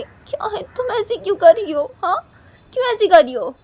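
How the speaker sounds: high, loud, crying plea; tearful plea. Acted. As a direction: plead through tears. Manisha upset in quarrel.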